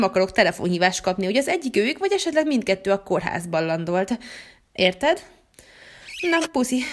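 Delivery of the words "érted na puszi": ordinary delivery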